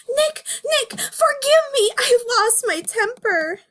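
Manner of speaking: high tearful apology, voice breaking; Judy crying. Acted. Judy begs Nick's forgiveness, high tearful voice. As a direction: beg forgiveness through tears, high.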